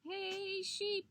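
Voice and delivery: high-pitched hail; calling out. A narrator's reading. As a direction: call over to get attention. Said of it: "he called out".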